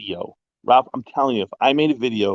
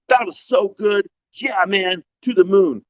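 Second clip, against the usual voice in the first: higher, hammed-up fan gush; mock gushing fans; 8 semitones higher, slower than usual. Mocking Spaces listeners gushing after his talk, higher mock gush. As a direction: mimic the fawning crowd. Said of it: "they're like".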